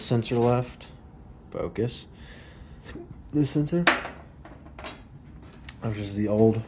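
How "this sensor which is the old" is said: suppressing hiccups. Real, not acted. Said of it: "Sorry, I have hiccups".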